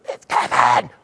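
rasped-out whisper with no voice left; voice lost. Retold or imitated. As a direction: push the words through a dead voice. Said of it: "I lost my voice".

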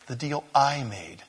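usual speaking voice

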